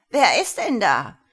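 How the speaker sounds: put-on very loud old woman's croak; croaky and very loud. A narrator's reading. Old woman asking who is at the intercom, croaky and very loud. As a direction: call it out loud, pitch jumping. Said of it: "krächzend und sehr laut durch die Sprechanlage".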